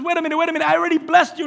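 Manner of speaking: high-pitched, urgent retold protest; flustered protest. Preacher voices Isaac interrupting his son, flustered. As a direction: act out a mildly flustered father objecting. Acted father confused by second son.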